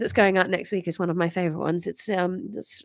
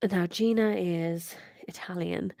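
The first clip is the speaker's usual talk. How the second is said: whispered aside; 3 semitones lower, 6 dB quieter than usual. Real, not acted. Whispered aside before voicing a character.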